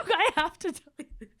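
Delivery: giggling through the words; can barely hold the laugh in. Real, not acted. Laughing while telling story.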